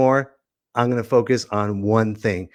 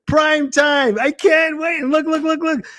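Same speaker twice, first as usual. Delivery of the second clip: much higher, giddy host; excited squeal. Acted.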